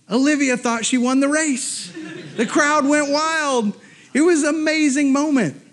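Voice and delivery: high-pitched, triumphant storytelling; exultant. Real, not acted. Dad telling the race's climax, exultant high delivery.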